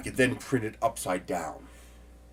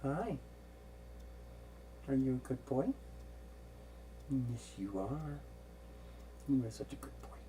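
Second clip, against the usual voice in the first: talking to a cat; 10 semitones lower, 15 dB quieter than usual. Real, not acted. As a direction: coo gently at the cat. Cooing to the cat.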